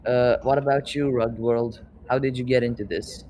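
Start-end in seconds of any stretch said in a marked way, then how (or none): none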